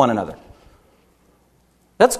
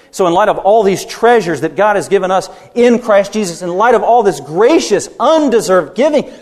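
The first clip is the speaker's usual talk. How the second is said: raised, rousing pulpit voice; preaching; 7 semitones higher, 4 dB louder than usual. Acted. Pastor urging his congregation, preaching full force. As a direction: lift the room, preach it with fire.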